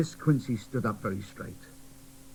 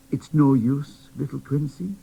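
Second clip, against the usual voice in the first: plain reading voice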